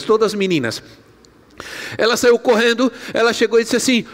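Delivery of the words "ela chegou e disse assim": ordinary talk, nothing marked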